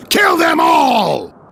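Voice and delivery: high, slow, full-throated yell to attack; furious battle shout. Acted. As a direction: roar the order at the warriors. Battle order against Volstagg's men.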